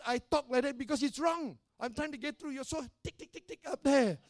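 high, exasperated telling-off; retold scolding. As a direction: replay a frustrated scolding at high pitch. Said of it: "I'm sorry I scolded you".

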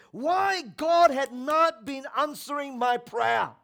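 loud, high, slow outburst; acted exasperated shout; frustrated, near anger. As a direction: demand it indignantly, drawing out the words. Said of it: "as close to anger".

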